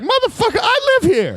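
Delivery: high, yelled movie rant at intruders; Nicolas Cage yelling. Retold or imitated. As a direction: snap it out like a furious homeowner. Cage confronting cops in movie.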